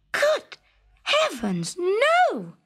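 high, shocked shouted refusal. A narrator's reading. Princess shouting at the frog asking for a kiss. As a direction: recoil with a shout. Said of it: "shouted the princess".

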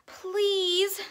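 high, loud storybook plea; acted boy's yell; pleading yell. A narrator's reading. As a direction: call out desperately for help. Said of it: "yelled Mark".

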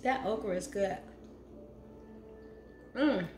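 chewing while talking; words through a mouthful; mouth full. Real, not acted. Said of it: "I hate to talk with food in my mouth".

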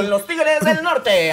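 slow, drawn-out presenter call; hammed-up show announcer; announcer intro. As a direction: present them like a stage emcee. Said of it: "Y con ustedes".

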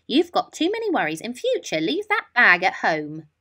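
loud, fast scolding; teacher scolding a late pupil, crossly. Acted. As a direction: tell her off sharply. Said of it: "the teacher was cross".

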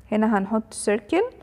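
ordinary talk, nothing marked

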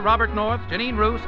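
ordinary talk

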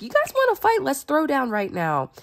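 picking a fight, half playful; mock challenge. Jephthah daring the Ephraimites to fight, cocky. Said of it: "But Jephthah was like".

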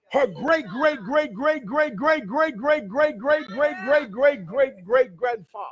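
chant-like string of evenly pulsed words; rhythmic repetition. Real, not acted. Preacher counts back generations to Abraham, rhythmic repetition. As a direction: roll each repeat in a steady swinging beat.

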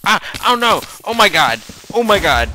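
loud, high cries of alarm; panicked yelling. Real, not acted. Gamer ambushed by enemies, panicked yelling. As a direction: yell out in sudden panic.

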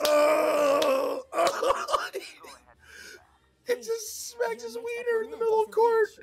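very high, strained squeaky voice; laughing to tears. Real, not acted. Streamer describing the clip in a high squeal of laughter.

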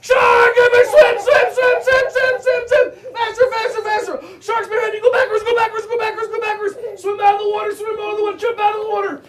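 high, loud frantic shouts; mock panic. Teacher playing shark escape with kids, in mock panic. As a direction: scream like a shark is chasing you. Game pretend shark chase.

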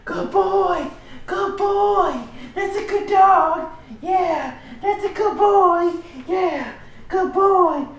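very high, bubbly praise for a puppy; squealing happy puppy-praise voice, stretched out; excited praise. Real, not acted. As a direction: gush over the dog like it's the best thing ever. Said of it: "now my tone's gonna change".

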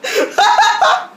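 high wheezing laugh; laughing hard after a sniff; burst of laughter. Real, not acted. Snorting pepper, reacting.

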